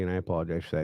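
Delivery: normal talking voice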